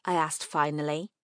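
ordinary delivery

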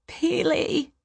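very high, grieving call; anguished cry. A narrator's reading. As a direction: cry the name out in a high pitch. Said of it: "he cried".

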